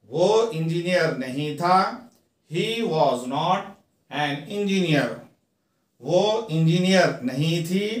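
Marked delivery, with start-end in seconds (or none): 2.5-5.1 slow, each word apart; slow teaching read. Shown as a demonstration. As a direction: dictate it slowly to students. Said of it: "teacher reads model sentence".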